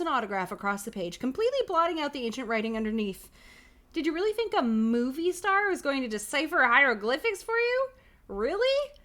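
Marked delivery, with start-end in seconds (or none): 3.9-8.9 high, loud incredulous question; mock the listener, pitch climbing at the end; mocking disbelief. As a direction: taunt with rising, hammed-up disbelief. Book mocks the reader's choice.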